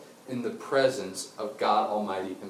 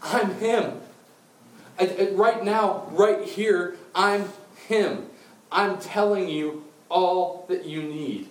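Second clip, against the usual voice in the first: high, slow, weighty proclamation; emphatic declaration; 8 semitones higher, 4 dB louder, much slower than usual. Acted. Jesus revealing himself to the woman, slow emphatic declaration. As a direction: declare it word by word, insistently.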